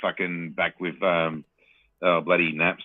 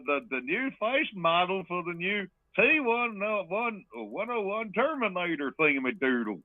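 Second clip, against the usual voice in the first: Arnold Schwarzenegger; 11 semitones higher, slower than usual. Acted. Host imitating a promo vignette for his co-host, hammed-up character voice. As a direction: draw it out high in a put-on character voice. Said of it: "Arnold Schwarzenegger, and he's supposed to be".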